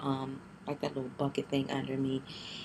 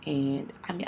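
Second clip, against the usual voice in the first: usual speaking voice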